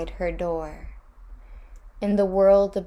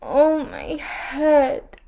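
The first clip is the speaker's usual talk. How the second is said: high, slow, louder wail; 8 semitones higher, 6 dB louder, much slower than usual. A narrator's reading. Ereshkigal wailing in pain on her throne. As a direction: draw out a long aching wail. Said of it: "wailed Ereshkigal".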